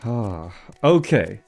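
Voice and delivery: usual speaking voice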